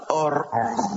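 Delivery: "Or, or" with the R stuck in the throat; failed rolled R. Retold or imitated. Israeli colleague attempts a rolled R, failing on "Or, or". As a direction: try and fail to trill the R in "Or, or". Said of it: "no le sale, no le sale".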